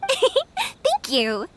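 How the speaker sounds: bright thanks through a laugh; giggling thank you. Acted. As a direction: laugh it out gratefully.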